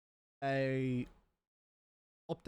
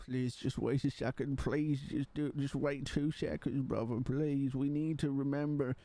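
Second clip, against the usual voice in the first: pleading inner voice. Retold or imitated. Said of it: "my head's like".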